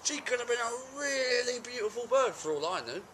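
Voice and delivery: high, protesting self-defence; aggrieved excuse-making. Acted. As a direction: plead your case in a high, wheedling pitch. Character makes excuses to partner.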